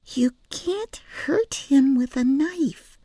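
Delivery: put-on high, slow child's hiss; hissed whisper. A narrator's reading. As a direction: warn her in a sharp whisper. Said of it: "hissed Penelope".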